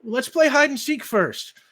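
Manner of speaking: put-on bright child voice pitched well up; little girl voice. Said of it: "she says".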